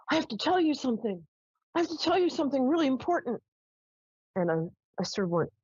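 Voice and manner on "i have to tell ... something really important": high, quick, pleading ghost voice; ghost's urgent plea. Retold or imitated. Ghost at her bedside, pleading to tell something. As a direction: beg urgently like a needy spirit. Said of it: "her energy was kind of like".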